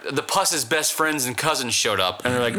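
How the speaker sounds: usual speaking voice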